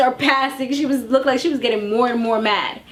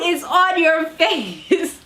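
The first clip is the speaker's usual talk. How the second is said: laughing; 5 semitones higher, much slower than usual. Real, not acted. Disbelief at denial, laughing.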